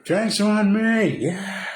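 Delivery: high, slow, drawn-out whoop; rowdy cheer. Retold or imitated. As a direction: crow it out like a drunk winner. Curly Bill celebrating his winnings.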